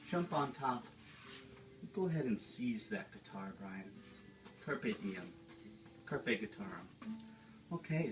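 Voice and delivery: gentle, soothing painting-show host voice, a Bob Ross send-up; Bob Ross Junior. Acted. Host of a painting show, talking to viewers at home: soothing Bob Ross voice. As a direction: coax the canvas along like a dreamy TV painter.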